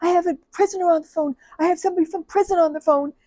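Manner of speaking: high, fast, panicked blurting; mock panic, gasping out the words; panicked hyperventilating. Coworker panicking to the supervisor about a parolee. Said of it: "really hyperventilating".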